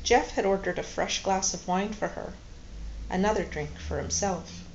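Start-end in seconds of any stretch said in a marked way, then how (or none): none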